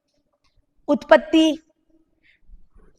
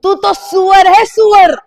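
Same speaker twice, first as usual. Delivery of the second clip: scornful parent; 5 semitones higher, 6 dB louder than usual. Retold or imitated. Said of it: "क्या बोलते थे?".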